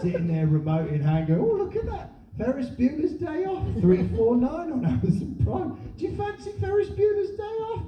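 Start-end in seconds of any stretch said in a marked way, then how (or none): 1.3-7.9 high, lightly delighted discovery; acted film browser. Acting a husband offering his wife a film, high-pitched interest.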